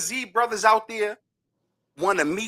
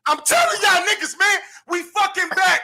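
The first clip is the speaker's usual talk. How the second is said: excited shouting; 10 semitones higher than usual. Real, not acted. Fan taunting New York after the win, excited yell. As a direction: let the win burst out of you. Celebrating his team's win.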